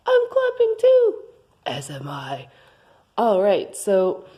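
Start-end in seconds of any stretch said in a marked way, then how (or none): 0.1-1.1 squeaky high-pitched character voice. Acted. High co-host voice returns. 1.7-2.5 very low, soft deep voice; dopey deep voice. Acted. Deep co-host voice returns.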